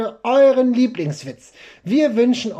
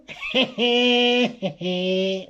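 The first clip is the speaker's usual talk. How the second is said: silly puppet laugh; puppet giggle; 3 semitones higher than usual. Acted. Laughs at own joke.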